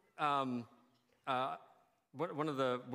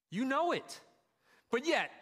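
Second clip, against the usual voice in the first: emphatic preaching; 9 semitones higher, 4 dB louder than usual. Real, not acted. Preacher pressing the point.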